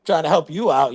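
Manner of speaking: mildly annoyed retelling; emotional. Retold or imitated. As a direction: huff about stupid people. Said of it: "I was more emotional".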